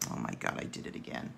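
hushed, fast self-scolding whisper; whispered to herself. Real, not acted. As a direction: mutter it under your breath, quickly. Mutters at own painting slip.

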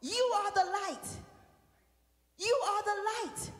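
loud, high preaching, half sung over music; full-force preaching. Acted. Pastor urging the congregation to shine, loud preaching. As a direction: proclaim it, ringing out to the church.

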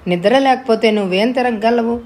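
ordinary delivery, nothing marked

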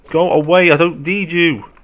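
high, loud rebuff; exasperated shout. Real, not acted. Player telling zombies to leave, exasperated yell. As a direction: shoo them off in frustration.

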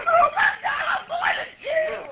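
real panicked cry, pitch leaping up; rising terror breaking into a high wail. Real, not acted. Grandmother on the emergency call, panicking about jail.

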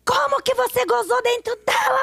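high, shrill-free jealous outburst, pitched well up; wife yelling in jealous rage; furious accusation. Retold or imitated. Jealous wife accusing her husband.

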